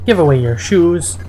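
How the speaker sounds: ordinary delivery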